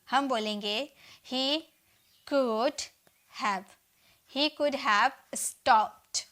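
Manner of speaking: ordinary talk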